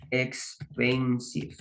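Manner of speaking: expensive stretched out syllable by syllable; slow word demonstration. Shown as a demonstration. Teacher writing up a new example word for students, slow and drawn out.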